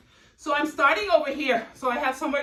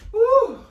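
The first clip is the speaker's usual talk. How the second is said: high whoop; 11 semitones higher, 9 dB louder than usual. Real, not acted. Cheering at the end of the set.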